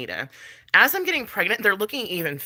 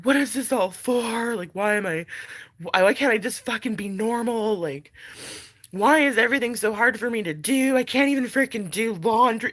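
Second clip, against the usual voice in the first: raised, self-dramatizing lament; mildly hammed-up hopeless plea; acted despair; 6 semitones higher than usual. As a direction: voice your own exasperated inner complaint. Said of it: "when I'm really feeling like shit".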